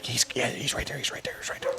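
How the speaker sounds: hushed, eager whisper at the field; tense whispered coaching under breath; urgent whisper. Retold or imitated. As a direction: whisper it like you don't want the dog to hear. Watching dog near the bird.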